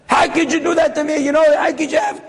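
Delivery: high, indignant retold reproach; acted outrage of the wronged father; flipping out. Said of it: "he's flipping at him".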